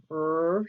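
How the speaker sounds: R said alone as a held "rrr"; isolated, sustained R consonant; drawn-out R sound. Shown as a demonstration. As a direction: hold the R as one long sound, like "rrr". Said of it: "Listen as I tell you a word".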